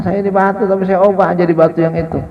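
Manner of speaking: a stone begging, its voice lifted; millstone's fearful plea. Acted.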